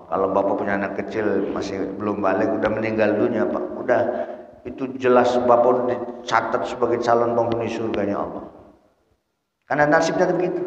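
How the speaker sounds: usual speaking voice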